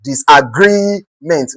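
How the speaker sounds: disagreement with a long, slow GREE; slow teacher's disagreement, leaning on GREE; stress on GREE. Shown as a demonstration. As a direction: draw out GREE in disagreement, then let ment fall. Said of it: "consider the word, the stem".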